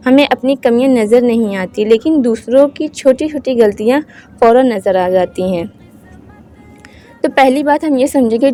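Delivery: normal talking voice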